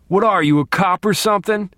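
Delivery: loud, high and defiant; brave defiance. Acted. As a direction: push back with false courage. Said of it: "But he got brave".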